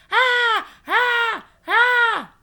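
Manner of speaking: short high loud screams; three piercing play-scary screams. A narrator's reading. Child clown circling two girls to scare them, screaming. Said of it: "I screamed".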